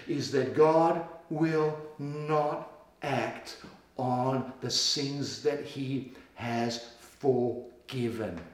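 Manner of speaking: slow, soft and sure; soft assurance. Real, not acted. Preacher reassuring the church of forgiveness, soft and slow. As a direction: reassure them gently.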